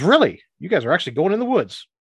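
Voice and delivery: high, loud, eager surprise; excitedly checking it's true; perked-up gnome. Acted. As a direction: perk right up with interest. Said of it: "you see him perk up".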